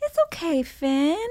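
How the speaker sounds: put-on high soothing little-kid voice; toddler voice. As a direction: comfort the baby like a small boy. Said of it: "being like".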